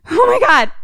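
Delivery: high, loud, stunned "Oh my God."; shocked gasp. Retold or imitated. Frozen family gasping in shock at the failed ritual. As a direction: blurt it out in horror.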